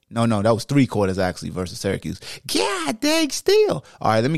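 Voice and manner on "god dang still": high, drawn-out "God dang"; impressed disbelief; amazed exclamation. Real, not acted. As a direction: let it out slowly in awe. Still amazed after correction.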